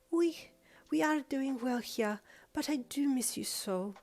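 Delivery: a fond French mum longing, slowly; French mum accent. Acted. Mother on the phone missing her daughter, French accent. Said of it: "weird fusion French, Germanic-ish something accent".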